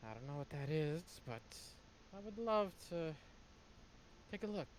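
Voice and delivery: rising eager manic voice; unhinged scholar. Acted. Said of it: "getting more and more unhinged".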